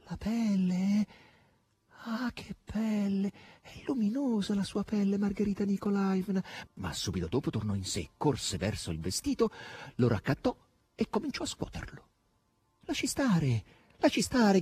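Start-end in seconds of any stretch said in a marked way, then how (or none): 0.1-6.4 high, drawn-out marvelling voice; awed veneration. A narrator's reading. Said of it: "disse quasi con venerazione". 12.8-14.6 raised, high-pitched command; mild shout. A narrator's reading. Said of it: "gridò Margherita".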